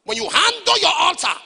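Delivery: high, loud preacher's cry; preaching shout. Real, not acted. Preacher shouting to the congregation, loud and high. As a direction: hammer the point home at full voice. Preacher driving home his point.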